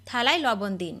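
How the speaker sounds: ordinary talk, nothing marked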